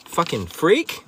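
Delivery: normal talking voice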